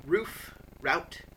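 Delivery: ordinary delivery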